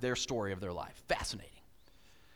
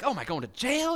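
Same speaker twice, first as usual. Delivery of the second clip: mock panic; 9 semitones higher, 3 dB louder than usual. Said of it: "You're like".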